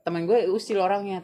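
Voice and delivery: normal talking voice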